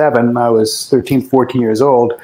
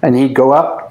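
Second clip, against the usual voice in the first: ordinary talk, nothing marked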